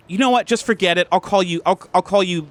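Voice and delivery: high, curt dismissal; acted huffy send-off; fed-up girlfriend. Girlfriend gives up, storms off.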